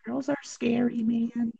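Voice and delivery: high, soft voice cracking. Real, not acted. Teen telling friends girls are scary, voice cracking. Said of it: "That was my voice crack".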